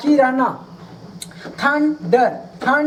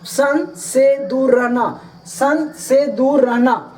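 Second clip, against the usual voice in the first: slow, deliberate repetition; slow drill; slower than usual. Shown as a demonstration. Teacher repeating the meaning slowly for students. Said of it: "teacher drills meaning for students".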